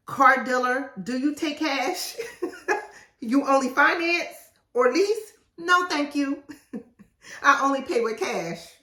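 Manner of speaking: high, bright, laughing mock sweetness; chirpy playful refusal. Author playing herself at the car dealer, high and laughing. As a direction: playfully turn down the dealer with a giggle.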